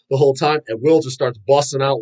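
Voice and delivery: ordinary delivery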